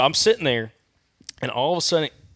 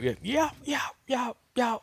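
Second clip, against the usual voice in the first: high, spaced imitated turkey yelps; four-note yelp; 11 semitones higher, 7 dB quieter than usual. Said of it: "like, four note".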